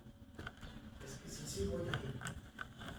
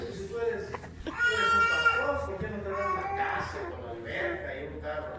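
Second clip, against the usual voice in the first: put-on child's pleading; 4 dB louder than usual. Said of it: "uno de mis hijos me dijo eso".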